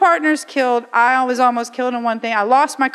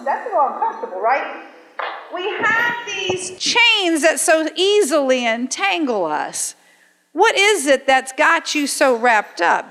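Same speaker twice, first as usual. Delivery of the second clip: usual speaking voice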